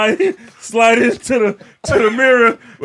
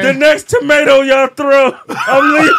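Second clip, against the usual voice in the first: half-joking threat; 7 dB louder, slower than usual. Real, not acted.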